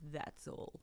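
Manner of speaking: lightly imitated hushed film boss; soft, voiceless dismissal; Meryl Streep. Guest quotes the fashion film boss, hushed imitation. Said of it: "think about Meryl Streep going".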